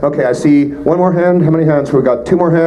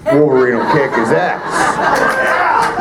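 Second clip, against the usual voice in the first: a hint of Wolverine, slow and amused; gruff Wolverine voice, lightly done; much slower than usual. Answering a fan's who-would-win question, slow light Wolverine growl. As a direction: growl it slowly like Logan, half laughing.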